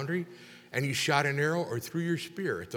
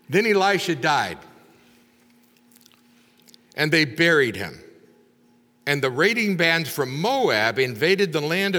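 loud, deliberate reading of the Bible text; scripture reading; 5 dB louder than usual. Acted. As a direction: proclaim the passage to the congregation. Reads the scripture passage aloud.

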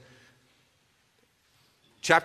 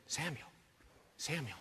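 hushed call; 4 semitones lower, 19 dB quieter than usual. Retold or imitated. God at boy's bedside, hushed.

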